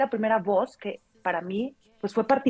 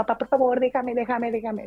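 put-on mild child's plea; 5 semitones higher than usual. Child begging father for records.

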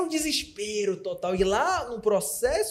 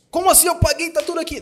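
much higher, loud disbelief; shocked outrage; 10 semitones higher, 5 dB louder than usual. Retold or imitated. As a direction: protest like a shocked traveller. Customer shocked at missing ticket.

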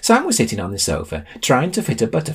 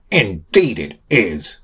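disgust; 2 semitones higher, 3 dB louder, slower than usual. Acted. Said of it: "said Ollie in disgust".

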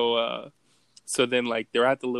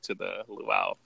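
usual speaking voice

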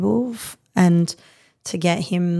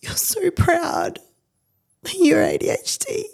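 real tears; 11 semitones higher than usual. Real, not acted. Mum moved by the friend proud of ADHD, crying. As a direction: voice breaking high with real crying. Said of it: "makes me emotional".

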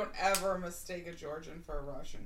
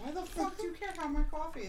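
indignant; 11 semitones higher than usual. Pretend. Mock indignation.

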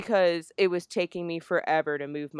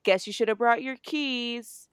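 higher, taunting imitation of a friend; mocking taunt; 6 semitones higher, 4 dB louder than usual. Friend locking her out, taunting about keys. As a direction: lightly rub it in, imitating her smugness.

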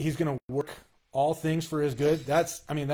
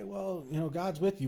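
high, soft mock-pious platitude; mocking cliche; 4 semitones higher, 5 dB quieter than usual. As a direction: toss off the stock comfort line.

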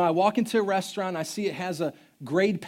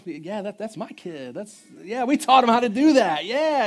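proud boast; 2 semitones higher, 6 dB louder than usual. Retold or imitated. Dad bragging about his son to a neighbour, loud and proud. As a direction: act the proud father showing off to strangers.

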